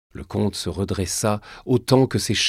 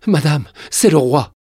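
high, loud cry of alarm; urgent alarm; 7 semitones higher, 10 dB louder than usual. Acted. Frightened servant alerting the queen mother, urgent alarm. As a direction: burst out with breaking news, panicked.

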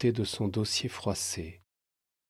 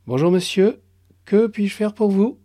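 accueil aigu, aimable et mécanique; guichetière polie; 11 semitones higher, 9 dB louder than usual. Acted. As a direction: sourire professionnel dans la voix. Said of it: "Sourire professionnel, fatigué, mécanique". (guichetière au sourire mécanique)

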